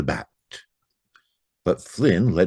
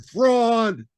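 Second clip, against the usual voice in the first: echo; 4 dB louder than usual. Acted. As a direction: let it ring back like an echo. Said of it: "and the echo answered".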